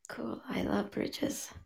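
slow hushed whisper; quiet at night. Real, not acted. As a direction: breathe the words quietly. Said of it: "I'm gonna whisper all night".

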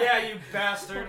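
loud, high, drawn-out imitation through laughter; hammed-up heckle bursting out; mock outburst. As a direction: mimic a sudden insult, laughing loudly. Mimicking the insult from the dark.

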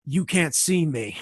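low, soft, laughing deadpan; mock Batista line. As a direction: mutter it low through a laugh. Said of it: "and Batista going".

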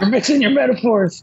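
high mock protest; outraged fan. Co-host heckling as the fan, high mock indignation.

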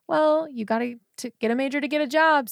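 lightly voiced insistent parents; parents' advice.

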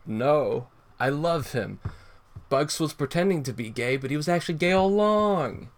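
high, rising dramatic declaration; dramatic confession. Retold or imitated. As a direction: confess it in a big cartoon voice. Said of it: "Bugs with the revelation".

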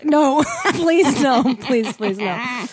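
slow queasy pleading; hungover groan. Real, not acted. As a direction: beg off the beer, queasy. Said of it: "Do you want a beer?".

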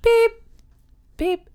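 high beep, then another; imitating a beep. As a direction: chirp two beeps. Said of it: "hear her go".

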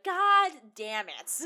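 loud, slow God damn it; exasperated outburst. Retold or imitated. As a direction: blurt it out, fed up. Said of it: "and I was like".

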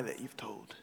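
hushed unvoiced whisper. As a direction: breathe it like a secret. Sermon aside on lying.

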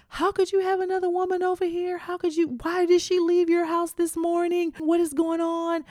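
high-pitched, rapid-fire pleading questions; distraught questioning. Retold or imitated. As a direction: re-enact a devastated girlfriend demanding answers. Acted distraught questioning of boyfriend.

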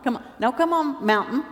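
ordinary talk, nothing marked

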